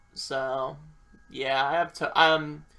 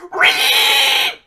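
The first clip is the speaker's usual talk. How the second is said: very loud, high, harsh shriek; mock Karen screech; 10 semitones higher, 12 dB louder than usual. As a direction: screech like an outraged Karen, hammed-up. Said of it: "acts like a total Karen bitch mode and goes".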